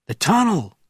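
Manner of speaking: high, loud cry; calling out. Acted. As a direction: cry out desperately. Crowd member calls out.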